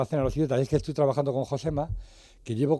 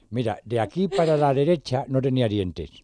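lightly imitating a half-toothless friend; mock lisp through missing teeth. Friend with no front teeth, a light mock lisp. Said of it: "Y hablaba así".

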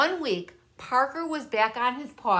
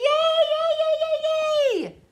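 very high squeaky cheers; gleeful penguin; 6 dB louder, slower than usual. A narrator's reading. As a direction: squeal with glee. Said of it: "flapped her flippers with glee".

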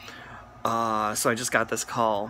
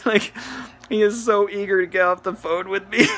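laughing through the words; can't stop giggling; real amused laughter; 6 semitones higher than usual. Real, not acted. Laughing while telling.

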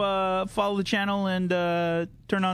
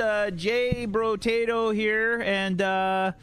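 put-on a light Canadian accent, friendly host; breezy Canadian streamer intro, mildly put on; hint of a Canadian accent. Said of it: "Who can do the best Canadian accent?".

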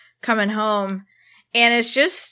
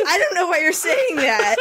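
mock exasperated snap, higher pitched; snapped; 7 semitones higher than usual. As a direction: snap it in laughing imitation of annoyance. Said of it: "like, snapped".

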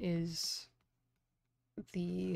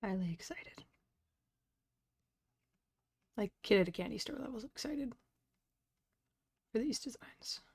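whispering; 3 semitones higher than usual. Real, not acted. Excited confession whispered to viewers.

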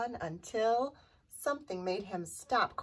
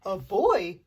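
loud storybook bear yell; big exclamation, kept child-friendly; 4 semitones lower, 7 dB louder than usual. A narrator's reading. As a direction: shout it out as a startled bear. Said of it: "yelled Bear".

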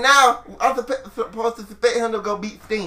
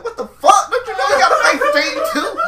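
high, loud, incredulous outburst; laughing mock outrage; mock disbelief; 11 semitones higher, 5 dB louder than usual. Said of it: "I'm like".

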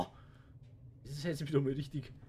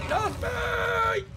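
screaming; 7 dB louder than usual. Real, not acted. Panicked gamer yelling at game.

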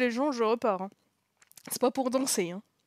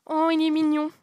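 cooing; 7 semitones higher, 9 dB louder than usual. Real, not acted. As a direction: gush over someone adorable. Cooing over a character.

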